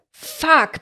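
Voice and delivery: lauter, hoher Fluch herausgebrüllt; gebrüllt. A narrator's reading. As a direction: schrei den Fluch heraus. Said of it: "brüllte er". (Emre erfährt vom Mord am Bruder, brüllt)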